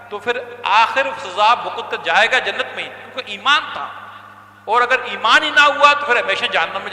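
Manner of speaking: loud, rising sermon, hammering each clause; fiery preaching. Acted. Preacher warning listeners of hell, loud fiery sermon. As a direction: drive the warning home to the crowd.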